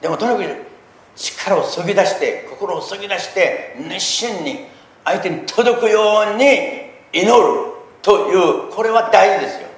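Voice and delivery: loud, high, slow preacher's plea; fervent preaching. Acted. Pastor exhorting listeners to pray fervently, loud high preaching. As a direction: stretch every word out loud and high to move them.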